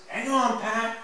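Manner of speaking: high call without the shout; high child-like echo of the call; lighter repeated call. A narrator's reading. Repeat after the loud shout.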